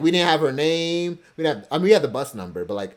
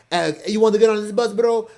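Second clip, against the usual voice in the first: higher, louder attempt at a Russian accent; a rough mock Russian accent; botched Russian accent; 8 semitones higher, 5 dB louder than usual. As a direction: imitate a tout with a slip into Russian accent. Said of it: "you know, a Russian accent".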